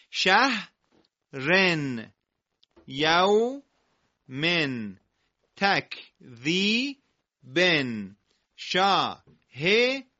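very slow, each word drawn out with final -un; drawn out syllable by syllable; teacher reading example words slowly; reading tanwin examples. Shown as a demonstration. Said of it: "مثالهای داخل کادر".

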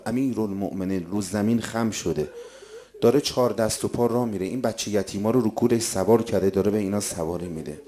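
soft, low storytelling voice; hushed story narration. A narrator's reading. Preacher recounting Ali and the orphans, hushed. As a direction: draw listeners quietly into the tale.

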